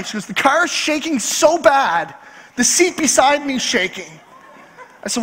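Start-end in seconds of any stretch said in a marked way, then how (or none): 0.3-4.2 slow, hammed-up complaint; mock exasperated. Wife complaining about the shaking car, mock exasperated. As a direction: stretch out the grievance, imitating a worked-up wife.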